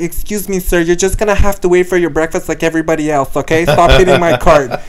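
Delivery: put-on voice of an impatient attendant; scolding flight attendant. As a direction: imitate an attendant telling off a passenger. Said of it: "And she's like".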